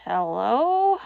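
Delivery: rough voice. Acted. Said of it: "a rough voice answered".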